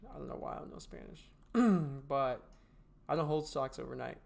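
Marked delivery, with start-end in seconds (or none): 0.1-1.3 quick whispered aside to himself. Real, not acted. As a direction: mutter it under your breath, half embarrassed. Self-mocking aside to chat.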